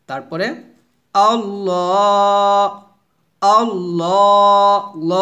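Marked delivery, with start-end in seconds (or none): 1.1-4.8 আল্লাহ with a thick, full, drawn-out L; আল্লাহ said thick and slow, the L full in the mouth; heavy Allah. Shown as a demonstration. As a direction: stretch আল্লাহ slowly with a heavy, dark lam. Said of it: "মোটো হবে, আল্লাহ হবে না".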